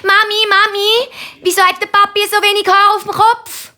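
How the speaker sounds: sehr hohe Kinderstimme; neugieriges Kind. A narrator's reading. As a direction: frag drängend und hoch wie ein kleines Mädchen. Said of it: "will Nina wissen". (sehr hochi Meitlistimm)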